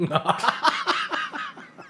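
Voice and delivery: high burst of laughter. Real, not acted. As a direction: crack up. (éclat de rire)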